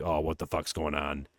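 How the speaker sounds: low, soft imitated wondering; hushed, low-pitched reaction as a viewer; uneasy aside. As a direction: say it low and under your voice.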